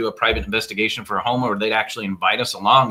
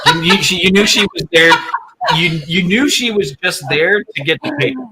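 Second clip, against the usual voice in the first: higher-pitched, talking through laughter; amused; 6 semitones higher, 4 dB louder than usual. Real, not acted. Teasing about a skeptic on the tour, amused and laughing. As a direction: say it with laughter bubbling up. Joking about the unbelieving woman.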